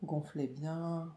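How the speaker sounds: normal talking voice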